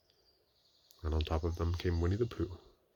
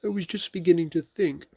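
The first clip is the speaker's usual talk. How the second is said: Pooh; 11 semitones higher, 6 dB louder than usual. A narrator's reading. Pooh voiced in story.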